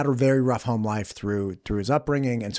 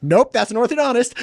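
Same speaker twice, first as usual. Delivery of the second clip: dad correcting; 11 semitones higher, 6 dB louder than usual. Retold or imitated.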